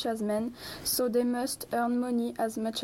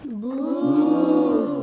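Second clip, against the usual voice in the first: long, loud drawn-out boo; 3 semitones higher, 9 dB louder than usual. Acted. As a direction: jeer the opponent with a swelling boo. Debaters jeer the opponent's argument.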